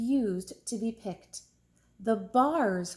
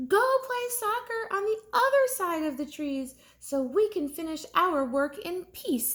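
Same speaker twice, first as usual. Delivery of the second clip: mild shout, raised pitch; rabbits shouting; 8 semitones higher than usual. Acted. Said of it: "the rabbits shouted at Ralph".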